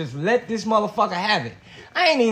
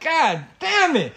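higher, drawn-out exasperated cry; retold scream; 6 semitones higher, much slower than usual. Man retelling his bad night to a friend, retold exasperated scream. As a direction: cry it out slowly, pitched up, fed up. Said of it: "I screamed".

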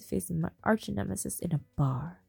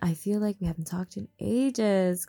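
pouty fake-friendly; 8 dB louder than usual. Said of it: "Chloe says with a pout".

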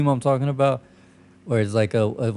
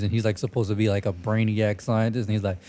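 ordinary talk, nothing marked

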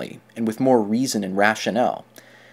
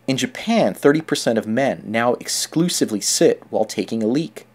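usual speaking voice

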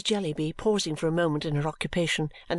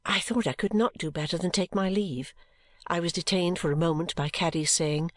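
usual speaking voice

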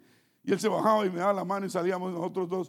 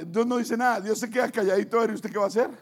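ordinary talk, nothing marked

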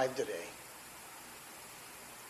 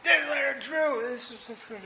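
answering as sponsor; 11 semitones higher than usual. Retold or imitated. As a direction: put on the sponsor answering the phone. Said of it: "I'm like".

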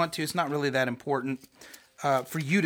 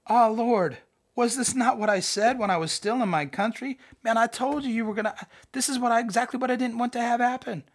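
Jonah complaining; 8 semitones higher than usual. Acted.

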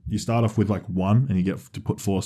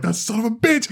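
high, indignant yelp; mock outrage; 13 semitones higher than usual. Spotting his friend secretly pre-ordered, a high outraged yelp. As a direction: act betrayed by your mate. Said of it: "I was like".

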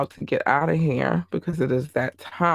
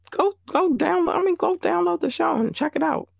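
much higher, pitch jumps up eagerly; excited push; 14 semitones higher than usual. Real, not acted. Host urging listeners to download, high and excited. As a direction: sell it, voice leaping high. Hyping the show.

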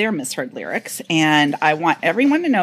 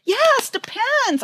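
high mock pitch; much slower than usual.

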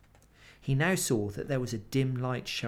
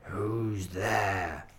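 growling wolf; 4 dB louder, much slower than usual. Acted. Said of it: "said in a growling voice".

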